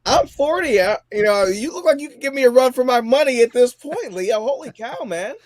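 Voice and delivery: high, loud, incredulous gushing; amazed. Real, not acted. Forty-year-old guest marvels at the sixty-year-old host, amazed. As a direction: exclaim it, pitched well up in amazement.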